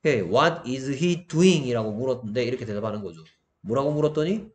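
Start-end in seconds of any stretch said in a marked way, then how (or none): 0.2-1.7 very slow, deliberate English example; slow model sentence. Shown as a demonstration. Teacher reading an example sentence to students, slowly. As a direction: spell the sentence out word by word.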